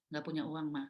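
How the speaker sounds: low, soft, unbothered reply; retold calm husband. Husband putting off hospital trip for money, calm. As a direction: imitate an unruffled husband putting things off. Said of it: "suami saya diem aja".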